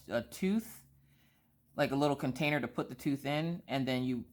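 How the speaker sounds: normal talking voice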